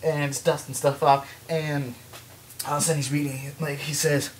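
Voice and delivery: ordinary talk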